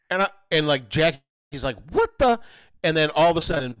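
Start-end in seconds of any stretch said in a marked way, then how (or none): 1.9-2.4 loud, high mock outrage; startled mother. Wife hears the slap, imitated startled outburst. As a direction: imitate a shocked parent cutting off.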